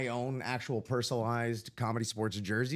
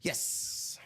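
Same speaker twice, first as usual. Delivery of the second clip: hissed yes, like a snake; breathy snake hiss on the s; drawn-out s like a snake; 5 dB quieter than usual. Real, not acted. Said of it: "I said that like a snake".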